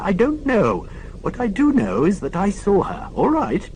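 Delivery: put-on a deaf old nurse correcting the inspector; prim old lady. Old lady scolding Morse for shouting.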